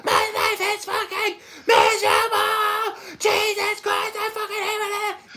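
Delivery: high, loud whiny rant; hammed-up screaming complaint; mock ranting clerk. Ranting character voice opening.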